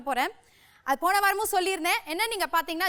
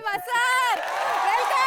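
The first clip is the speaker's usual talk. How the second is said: loud welcome; 4 semitones higher, 4 dB louder, much slower than usual. Real, not acted. Hosting grand guest entrance.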